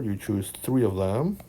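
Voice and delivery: usual speaking voice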